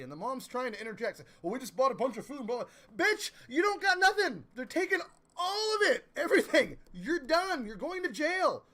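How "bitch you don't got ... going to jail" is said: high, loud furious shout at the mom; furious yelling. Retold or imitated. Streamer yells at the drug-addict mom in the story, furious shouting. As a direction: tear into her at full volume, high and loud.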